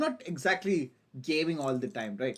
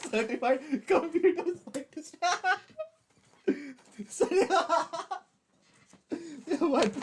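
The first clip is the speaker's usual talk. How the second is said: said through laughter, higher; laughing; 13 semitones higher, 6 dB louder than usual. Real, not acted. Streamer mocking his own miscount, laughing through it. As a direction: laugh at yourself while saying it.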